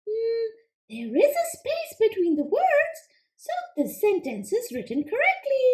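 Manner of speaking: high, quick little frog puppet, eager to answer; squeaky-high puppet frog pleased with itself; Froggy puppet voice. Acted. Frog puppet answering the teacher, high and eager.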